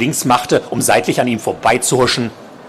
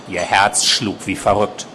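plain reading voice

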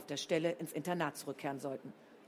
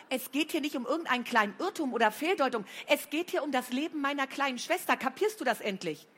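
loud, high furious rebuke; 7 semitones higher, 5 dB louder than usual. A narrator's reading. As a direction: tear into her about her sister. Flo furious at Blanka.

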